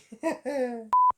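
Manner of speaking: short amused laugh. Real, not acted. Laughing at Connery's accent.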